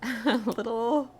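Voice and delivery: nervous giggle through words; laughing. Real, not acted. Nervous laugh about next episode.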